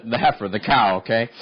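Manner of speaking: usual speaking voice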